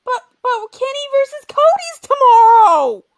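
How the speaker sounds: high, loud, slow stammering plea; mock pleading protest. Protesting to Keith about the match, high pleading whine. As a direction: whine it like a let-down fan.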